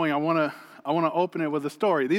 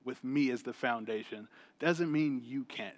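much softer, low and gentle; soft voice of God; 4 semitones lower, 8 dB quieter than usual. Retold or imitated. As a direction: speak it like a quiet inner conviction. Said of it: "And God told me".

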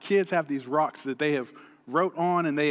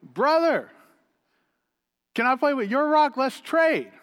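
put-on lifted, high, coaxing little girl; sweet daughter voice; 8 semitones higher, 4 dB louder than usual. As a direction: sweetly wheedle for the trade. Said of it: "She goes".

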